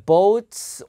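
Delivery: boats stretched on a long "oh", loud; O sound. Shown as a demonstration. Teacher introducing a word, boats with a long loud O. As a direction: hold the "oh" in boats, high and loud. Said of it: "O 발음이에요".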